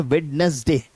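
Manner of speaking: वेडनेसडे with D and NES sounded, "wed-nes-day"; common mispronunciation. Shown as a demonstration. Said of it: "अक्सर कह देते हैं".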